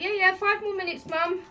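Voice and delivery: put-on high, stalling child's voice; whiny kid. Child to mum at teatime, stalling in a high whine.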